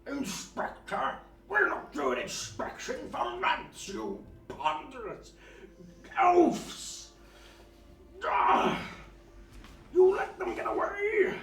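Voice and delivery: very high, loud furious screech; furious booming voice. Acted. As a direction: rage down from the walls. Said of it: "voice echo through".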